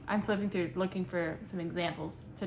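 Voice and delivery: usual speaking voice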